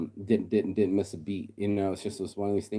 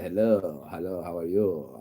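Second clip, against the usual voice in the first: slick greeting; slower than usual. Retold or imitated. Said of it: "And then Lucci's like".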